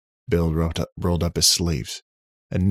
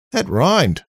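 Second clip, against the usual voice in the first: high, loud Aussie delight; chuffed Australian aside; Australian accent; 11 semitones higher, 8 dB louder than usual. Acted. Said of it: "Australian accent".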